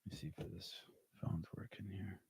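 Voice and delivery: breathy whisper to himself. Real, not acted. Streamer checking his phone before calling his wife, whispered.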